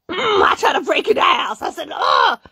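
loud, high grunting fury; reenacted outburst. Reenacts flipping out at house.

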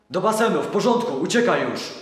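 loud camp-guard commands; barked commands. Acted. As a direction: bark them out without thinking. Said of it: "komenduje automatycznie".

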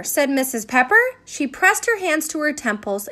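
ordinary delivery

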